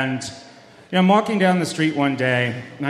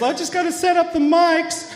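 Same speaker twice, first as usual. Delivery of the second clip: much higher, dazed Southern chef voice; Muppet chef voice. Retold or imitated. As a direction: imitate the spaced-out guy in the alley. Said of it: "like Chef from the Muppet Show if he had a Southern accent".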